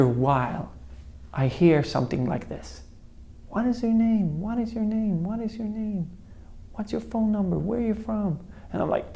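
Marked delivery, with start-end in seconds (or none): 3.5-8.4 fading distant questions. Retold or imitated. Voices heard while coming round.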